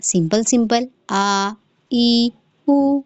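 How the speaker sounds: slow, clean glottal a, i, u; plain hamza sounds. Shown as a demonstration. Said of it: "حمزہ آواز".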